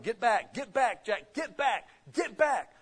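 high, loud repeated parental commands; urgent warning. Acted. Parent to a child near an outlet, urgent warning. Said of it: "Yeah, you warn them".

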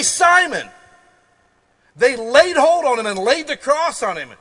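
fiery sermon delivery; preaching at full force. Acted. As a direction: hammer each phrase to the congregation.

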